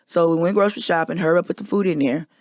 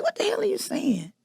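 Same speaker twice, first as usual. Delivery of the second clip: high-pitched disbelief, pulled back in volume; baffled, quiet outburst much higher; incredulous; 12 semitones higher, 6 dB quieter than usual. Retold or imitated. Confronted by her partner about which floor, high baffled disbelief.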